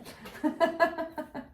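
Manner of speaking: short run of light chuckles; amused laugh. Real, not acted. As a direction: chuckle at your own clumsy phrasing. Laughs at her own wording. (petit rire amusé)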